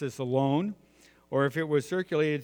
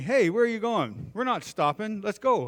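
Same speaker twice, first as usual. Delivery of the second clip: higher, mock-indignant kid calling back; retold yell back; 7 semitones higher than usual. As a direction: imitate a young worker yelling after someone. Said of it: "this young kid yells back at me".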